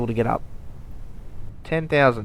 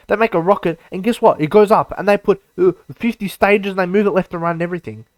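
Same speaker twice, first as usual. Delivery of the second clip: heated rant; 5 semitones higher, 5 dB louder, faster than usual. Real, not acted. Ranting about other YouTubers.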